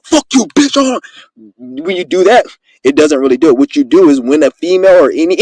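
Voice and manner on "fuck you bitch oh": higher-pitched aggressive cussing; aggressive comeback. Acted. Demonstrating the wrong comeback to an aggressive person, aggressively. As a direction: come back hot and aggressive, cussing. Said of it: "you don't come back with that".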